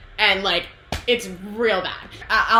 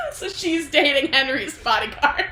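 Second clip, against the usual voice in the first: higher voice breaking into laughter; laughing; 7 semitones higher, 4 dB louder than usual. Real, not acted. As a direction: deliver the reveal while cracking up. Cracks up at the reveal.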